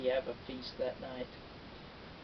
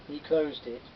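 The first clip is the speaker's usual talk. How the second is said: ordinary delivery, nothing marked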